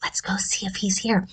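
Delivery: hushed whisper. Acted. Teacher sneaks to find Chef, whispering.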